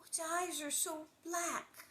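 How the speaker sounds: high, slow, mild mock sob; drawn-out tearful child's voice, lightly acted; sobbing. A narrator's reading. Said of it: "Laura sobbed".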